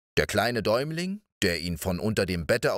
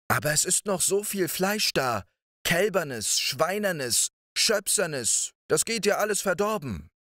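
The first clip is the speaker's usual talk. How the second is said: a higher, nervous excuse; lightly voiced wife talking him down; wife to her husband, higher, trying to sound casual; giant's wife; 9 semitones higher than usual. Wife argues for waiting.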